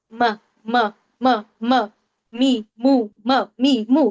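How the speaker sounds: isolated m sounds, lips closed then vowels; sounding out meem. Shown as a demonstration. Teacher to students, sounding the letter meem for them. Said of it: "ادا کر کے غور کیجیے".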